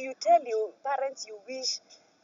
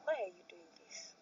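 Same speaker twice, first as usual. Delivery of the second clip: very soft, subdued retold question; not raising voice; 3 semitones lower, 12 dB quieter than usual. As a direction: re-enact asking it quietly, no force. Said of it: "I'm talking so slow".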